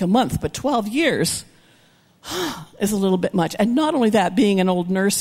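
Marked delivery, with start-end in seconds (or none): 2.3-2.7 quick soft gasp of shock; mock gasp. Preacher gasping at twelve years of bleeding, soft shocked gasp. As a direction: gasp as if appalled.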